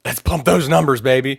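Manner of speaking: playful hype cheer, pumped up; hyped label quote. Retold or imitated.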